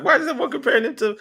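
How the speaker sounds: high, quick baffled question; incredulous. Retold or imitated. Retelling own baffled reaction to comparisons.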